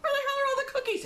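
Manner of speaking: high, fast, baffled grandma; shrill puzzled outburst about cookies; exasperated grandma. Retold or imitated. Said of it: "she probably went in there and went".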